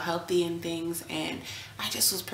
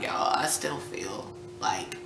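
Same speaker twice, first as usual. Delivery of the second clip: pained whisper; 3 semitones lower, 3 dB quieter than usual. Retold or imitated. In pain during stitching.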